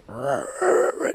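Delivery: drawn-out rattling growl; creepy growl. Retold or imitated. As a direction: imitate an eerie voice as a long growl. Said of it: "like this creepy-ass voice".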